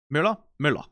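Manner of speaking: "Møller" said twice, once with stød and once without; contrasting Danish words. Shown as a demonstration. Streamer teaching viewers stød, careful contrasting Danish. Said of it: "Those are two different words".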